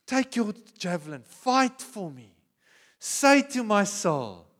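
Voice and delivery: high, loud pleading to God, slow on fight; loud crying prayer. Acted. The soul crying out loudly to God in prayer. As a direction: cry out to God to fight for you. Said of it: "That's the cry of my soul".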